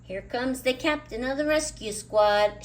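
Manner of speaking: shout. A narrator's reading. Charlie Brown announces Snoopy's rescue squad, loud shout. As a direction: call it out like a herald. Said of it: "shouts Charlie Brown".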